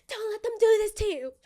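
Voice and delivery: very high, loud squeal; high-pitched mock-desperate self-warning; frantic inner voice. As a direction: plead with yourself in a high, put-on wail. Inner voice escalates, repeated high.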